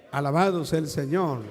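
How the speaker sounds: loud fervent exclamation; preacher calling out to his congregation; loud praise. Real, not acted. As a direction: call out the praise to the church.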